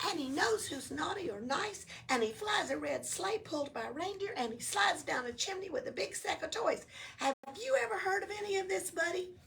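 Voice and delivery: high, chirpy little elf voice; cheerful elf. Acted. Elf explaining to Oscar, high and bouncy. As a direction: introduce yourself like a perky elf.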